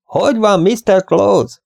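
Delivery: high, projected call. A narrator's reading. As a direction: pitch it up for someone hard of hearing. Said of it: "Megemeltem a hangom". (felemelt hangon)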